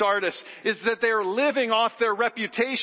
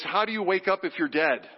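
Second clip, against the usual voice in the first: ordinary talk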